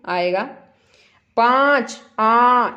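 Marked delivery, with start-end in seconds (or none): 2.2-2.7 loud आ sung through the nose; nasal aa sound. Shown as a demonstration. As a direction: ring out आ nasally. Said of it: "इसमें भी आ की sound आई ना". (आ को ज़ोर से नाक से बोलें)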